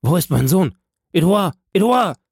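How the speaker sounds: high, loud, panicked calling; frightened cry. A narrator's reading. Father screams for his missing son, in fear. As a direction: cry out in rising dread. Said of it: "schrie nach Edouard mit einem solchen Ton der Angst".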